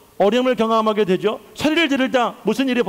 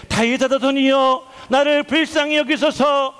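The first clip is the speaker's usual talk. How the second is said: slow, higher-pitched drawn-out plea; crying out; 5 semitones higher than usual. Acted. Bartimaeus crying out to Jesus for mercy, drawn-out cry. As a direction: cry out for mercy, stretching the words. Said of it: "그가 더욱 크게 소리질러".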